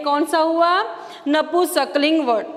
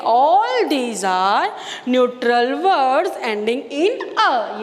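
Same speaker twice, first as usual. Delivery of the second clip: very slow emphasis; much slower than usual. Shown as a demonstration. Teacher stresses the key rule.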